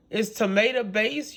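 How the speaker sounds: ordinary talk, nothing marked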